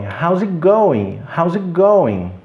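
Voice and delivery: "it going" run as "ig going"; T omitido. Shown as a demonstration. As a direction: drop the T in "How's it going". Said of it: "praticamente não pronuncio aquele T". ("How's it going" sem o T)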